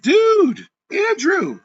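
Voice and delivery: high shout on Dude, fading on the name; yelling at the broker in the lobby; retold yell. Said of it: "we start screaming".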